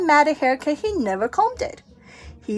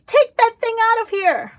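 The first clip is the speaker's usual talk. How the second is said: high, sharp scolding; scolding grown-ups; 9 semitones higher than usual. Acted. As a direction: shoo him away crossly.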